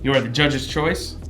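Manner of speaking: ordinary delivery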